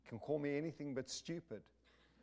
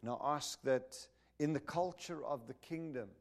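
prayer; 3 semitones lower than usual. Acted. Leads prayer.

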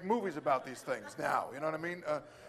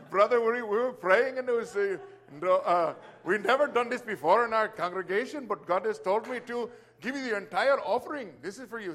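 put-on louder, lifted Indian-accent impersonation; imitated Indian accent; 3 semitones higher, 6 dB louder than usual. Church elder handing over the offering, imitated Indian accent. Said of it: "it's so hard not to do it".

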